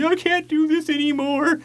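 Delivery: high-pitched whiny fake wail; mock crying. Imitating a man crying in the hallway, mock wail. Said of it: "out in the hallway crying".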